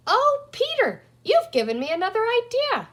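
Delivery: light up with a sudden idea; thrilled girl's exclamation; excited. A narrator's reading. Penny struck by idea.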